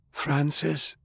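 soft, high, heaved-out name; dying man labouring to call his grandson; heaving. A narrator's reading. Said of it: "he heaved".

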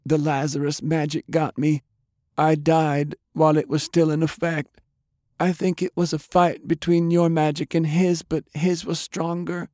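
high-pitched woman's voice, sorrowful; dying woman, pleading. A narrator's reading. Undead Delilah asking Jake to let go, pleading. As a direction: plead gently in a high female register.

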